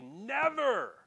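"Never" high, loud and drawn out; emphatic. Acted. Preacher correcting the translation, "Never" loud and emphatic. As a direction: throw all the force on "Never". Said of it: "It really ought to say".

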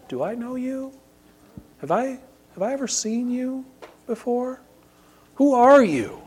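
high, soft and hushed questioning king; king's voice. Acted. King on his throne questions the trespasser, hushed and high. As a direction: lift the pitch and hush it like a puzzled king.

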